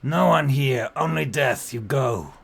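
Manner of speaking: loud, forceful orc voice in broken speech; orc child, warning. Acted. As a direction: warn intruders off as an orc child.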